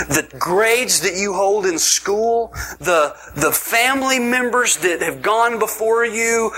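rising, driving preacher's build; preaching at full force. Acted. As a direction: lift the room toward the amen. Preaching climax to congregation.

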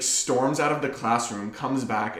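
usual speaking voice

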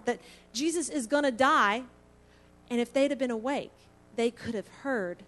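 ordinary talk, nothing marked

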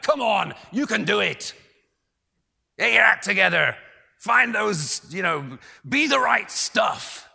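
put-on loud, high American locker-room coach accent; rally the team like an American coach; hammed-up American coach pep talk. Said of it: "That was my best American accent".

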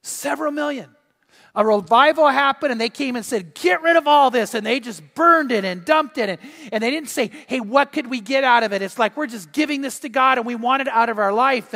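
loud, high, fervent sermon delivery; impassioned preaching. Acted. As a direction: preach it at full force, voice lifted. Preaches at full force.

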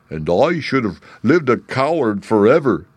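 a lightly voiced lion, declaring firmly. Lion tells Glinda he would have stayed a coward, lightly voiced and firm. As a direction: declare it like a proud but once-timid lion. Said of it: "declared the Lion".

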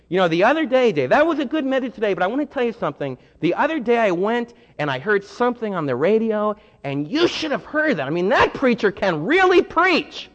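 high, eager churchgoer gushing about another preacher. Retold or imitated. Churchgoer to pastor, eagerly praising another preacher. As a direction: chatter on excitedly about the radio preacher.

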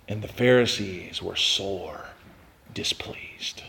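low, soft whisper; sad hush. Acted. Preacher reads the Pharisees' displeasure, whispered low. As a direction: breathe it out sadly. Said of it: "so sad".

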